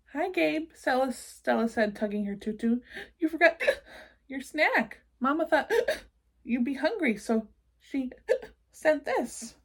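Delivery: little sister bringing a snack; little monster sister. Acted. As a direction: play a tiny hiccuping girl.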